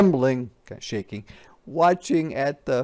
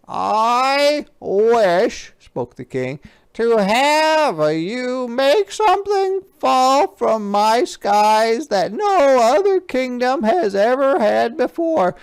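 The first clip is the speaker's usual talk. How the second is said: loud, high, commanding king; bossy king; 10 semitones higher, 6 dB louder than usual. Acted. King orders page Bartholomew, loud and high. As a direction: bark orders like a pompous king.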